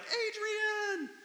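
high, strained, mock-yelled call for Adrian; Rocky's cry. Preacher imitating Rocky calling Adrian, high mock cry. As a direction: belt it out like a beaten boxer calling his love. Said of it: "Rocky's all blooded up, like".

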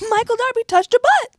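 high, loud mock headline shout. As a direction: announce it like a scandal.

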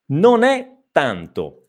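"non è tanto" slowly, n linked into è; slow model phrase. Shown as a demonstration. Teacher slowly modelling "non è tanto" for learners. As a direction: link "non è" slowly as one word. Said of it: "Attenzione a questo non è".

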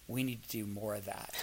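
hushed, spent after crying; almost murmured, drained; quiet and soft. Retold or imitated.

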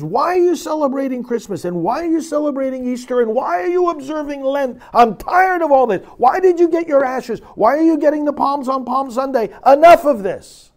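high, loud, fed-up rebuke; an imagined Jesus shouting, tired of the rituals; exasperated Jesus shouting. Pretend. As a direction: rail at the people like an exasperated Jesus. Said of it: "Jesus shouting those words to us".

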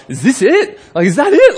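high, disbelieving laugh; incredulous disappointment. Retold or imitated. Customer walking into an overhyped restaurant, incredulous. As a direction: act let down, laughing in disbelief. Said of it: "you're like".

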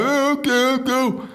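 high, silly puppet voice; tiny hammed-up puppet noises; little puppet. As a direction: squeak it like a small puppet leaving. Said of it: "like some little puppet".

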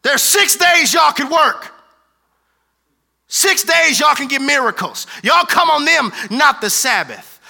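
high, loud indignant shout; angry pastor. Retold or imitated. Indignant synagogue ruler shouting at the healed woman. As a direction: bark at the crowd to sit down. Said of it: "he's like".

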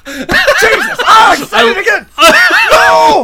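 high, loud laughing outcry; exasperated shout. Real, not acted. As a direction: cry out at catching yourself doing it. Catches self apologizing again, laughing.

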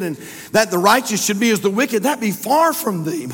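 loud, high preacher's reading; impassioned scripture reading. Acted. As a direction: ring the verse out over the congregation. Reads Abraham's plea from scripture.

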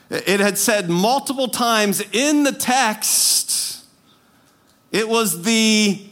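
ordinary delivery

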